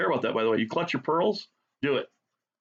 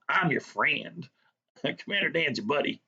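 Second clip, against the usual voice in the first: mock friendly schemer; 3 semitones higher than usual. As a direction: play the phony pal, chuckling between phrases. Mock-sinister reassurance between put-on chuckles.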